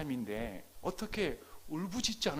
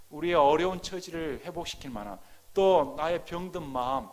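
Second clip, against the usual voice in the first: ordinary delivery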